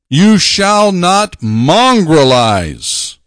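very slow, proclaiming "You shall not"; solemn proclamation. Acted. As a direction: intone it like scripture read aloud.

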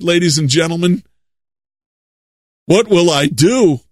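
ordinary talk, nothing marked

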